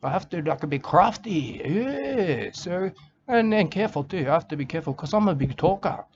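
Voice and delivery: ordinary delivery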